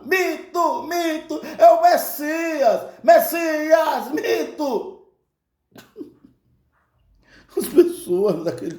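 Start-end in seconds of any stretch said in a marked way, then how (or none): 0.0-5.1 high, raised chanting like a fanatical crowd; multidão gritando. Retold or imitated. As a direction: shout it as an adoring mob. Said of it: "as pessoas começaram a gritar". (gritos de multidão fanática)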